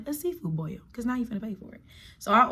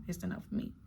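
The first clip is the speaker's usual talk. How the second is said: soft whispered aside; 14 dB quieter, faster than usual. Real, not acted. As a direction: breathe it out softly. Unvoiced trailing aside.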